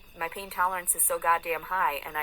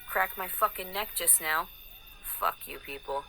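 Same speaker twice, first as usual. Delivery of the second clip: normal talking voice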